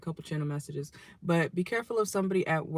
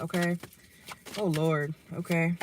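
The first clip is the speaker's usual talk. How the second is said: ordinary talk, nothing marked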